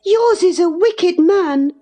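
put-on very high, indignant girl's retort. A narrator's reading. Catherine retorts to Linton about his father, indignant. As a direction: hit back in a high girlish voice. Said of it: "retorted Catherine".

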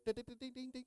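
high, bright dings like pills on a plate; ping each ding up high like pills landing; pill ding imitation. Imitating pills hitting the plate, high ding sounds. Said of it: "the sound of those pills hitting".

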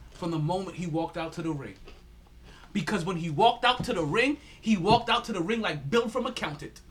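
ordinary talk

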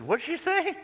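a baffled man asking aside; surprised, high-pitched query; puzzled question. Retold or imitated. Attorney puzzled by the girl.

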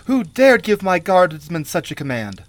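high, loud imperious demand; outraged majesty. A narrator's reading. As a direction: raise the voice like an affronted queen. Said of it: "stiffening with outraged majesty".

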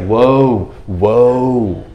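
a stunned, drawn-out mock gasp of wonder; awed whoa. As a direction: sound like a disciple struck speechless. Thomas awed meeting risen Jesus.

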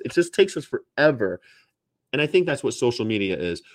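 ordinary talk

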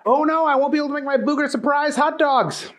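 quick, high worried cartoon voice; worried Booger voice. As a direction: panic like a silly cook.

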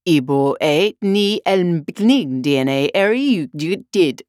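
Alice puzzling out reversed text; reading gibberish backwards. Acted. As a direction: stumble through the back-to-front words. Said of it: "It was like this".

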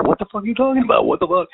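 high, fast, exasperated yell of disbelief; mock-furious what the fuck; retold angry outburst. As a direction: throw it back at her, outraged. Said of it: "I was mad".

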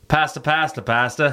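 put-on pasta repeated in a light New Zealand accent; Kiwi accent. Retelling a Kiwi video, repeating pasta in accent. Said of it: "And they were like".

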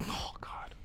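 breathless whisper; real hushed aside; whispered oh God. Real, not acted. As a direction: whisper it, awestruck. Reacting to Margot Robbie.

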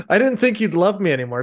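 hammed-up pouty wife, rushing it; mock pleading wife. Mocking the ogre's wife to his podcast friends, whiny and high. Mocking Shrek's wife's plea.

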